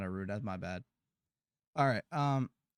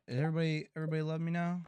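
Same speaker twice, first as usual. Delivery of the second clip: usual speaking voice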